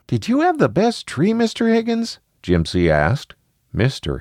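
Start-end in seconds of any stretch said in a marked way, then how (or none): 0.1-2.2 put-on high-pitched little kid question; high, inquisitive child voice; curious child. A narrator's reading. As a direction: ask like a curious young child.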